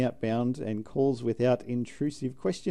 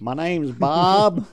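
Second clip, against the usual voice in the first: slow, louder, higher put-on voice; silly caller voice; 5 semitones higher, 7 dB louder, much slower than usual. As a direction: drag it out like a goofy caller. Mock caller voice.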